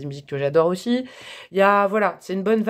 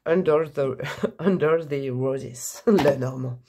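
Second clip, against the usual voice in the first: slow, put-on English accent; hammed-up English accent, breaking into laughs; 4 semitones lower, much slower than usual. Announcing the third deck to viewers, mock English accent. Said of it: "attention, accent anglais".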